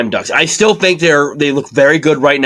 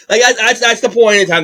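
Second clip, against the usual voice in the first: higher, mock Alberta accent on "that's the point in time"; 7 semitones higher than usual. Shown as a demonstration. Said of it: "That's my best Alberta accent".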